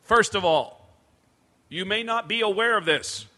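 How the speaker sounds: loud, booming arena announcement; stage announcer. Retold or imitated. Comedian posing as band staff addresses the crowd, announcer voice. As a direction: address a stadium crowd with authority.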